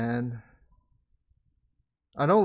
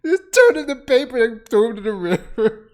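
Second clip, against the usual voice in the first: incredulous laughing; 9 semitones higher, 8 dB louder than usual. Real, not acted. Laughing at the scene.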